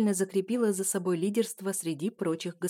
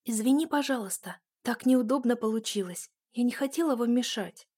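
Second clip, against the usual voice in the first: embarrassed whisper; 5 semitones higher than usual. A narrator's reading. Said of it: "прошептала смущённая Никсаэла".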